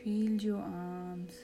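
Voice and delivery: soft, slow meditation voice; calm, hushed, lead them into relaxation; guided meditation. Acted.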